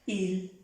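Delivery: il with a clear i; il, never él; French il. Shown as a demonstration. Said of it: "por decir él en francés".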